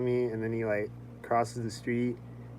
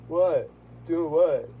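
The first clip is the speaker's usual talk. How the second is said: higher, louder mock innocent what; smirking innocence; 5 semitones higher, 4 dB louder, much slower than usual. Smirking driver, lightly voiced mock innocence. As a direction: play dumb with a smirk. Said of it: "he had this smirk".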